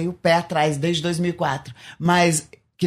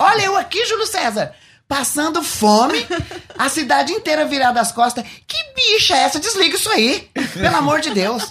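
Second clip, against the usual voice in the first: much higher, mocking camp voice, imitating his old self; mocking flamboyant voice; 7 semitones higher than usual. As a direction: sneer at the pastor on TV in a high camp voice. Mocks ex-travesti pastor on TV.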